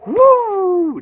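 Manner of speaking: long high whooping yell; wild cheer. Real, not acted. Fan celebrating the Leaf win, long loud whoop. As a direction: throw your head back and whoop.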